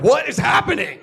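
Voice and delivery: high, loud disbelieving yell; shocked outcry. Retold or imitated. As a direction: blurt it out in stunned disbelief. Retold shock at friends' joke.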